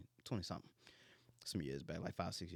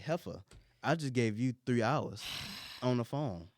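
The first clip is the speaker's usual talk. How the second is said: retold comeback, louder and emphatic; indignant retort; 2 semitones higher, 9 dB louder than usual. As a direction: fire back loudly in a retold argument. Retold argument, answering her attitude.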